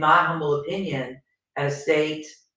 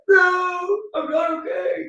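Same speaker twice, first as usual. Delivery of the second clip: retold tearful cry; 12 semitones higher, 4 dB louder, slower than usual. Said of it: "I'm like".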